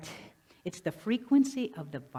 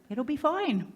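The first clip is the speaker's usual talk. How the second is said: high, lilting mock reassurance; mock cheerful; 9 semitones higher than usual. Speaker echoing the complacent choice to the audience, high mock cheer. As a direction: lift it high, brightly dismissing any worry. Mocking repeat of complacent line.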